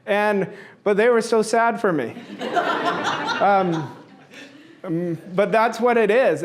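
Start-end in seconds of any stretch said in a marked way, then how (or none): 2.2-3.4 short airy laugh; chuckles at the memory. Real, not acted. Father laughs that his kids felt sad for him. Laughs about kids' pity.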